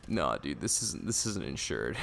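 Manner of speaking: low, slow and soft stoner drawl; sloppy stoner. Retold or imitated. Stoner clinic guy turning down insurance, sloppy stoner voice. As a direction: imitate a sloppy stoner, dropped low and lazy. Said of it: "a really weird, sloppy stoner voice".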